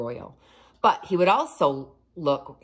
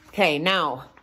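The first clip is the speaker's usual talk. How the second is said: ordinary delivery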